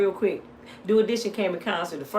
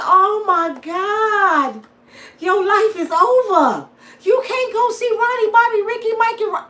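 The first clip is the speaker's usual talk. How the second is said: put-on dramatic kids; 13 semitones higher, 7 dB louder than usual. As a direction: wail like the world just ended. Kids mourning missed concert.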